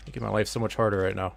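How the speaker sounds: ordinary talk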